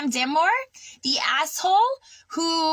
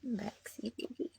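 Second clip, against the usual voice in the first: much softer, tender coo; 4 semitones lower, 17 dB quieter than usual. Real, not acted. Cooing softly to her cat, tender and hushed.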